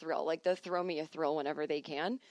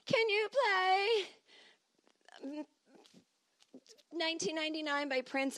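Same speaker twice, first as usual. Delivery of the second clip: pushy party guest; 10 semitones higher than usual. Retold or imitated. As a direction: ham up a clueless guest asking for a song. Pushy guest requesting a song.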